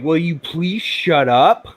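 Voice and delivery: drawn-out, exasperated retold plea; fed-up plea, stretched out slowly. Telling friends what they said to the noise, exasperated. Retelling snapping at annoying noise.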